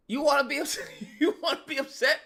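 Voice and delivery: high, laugh-broken incredulity; laughing disbelief. Real, not acted. Host ridiculing upset car owners, laughing.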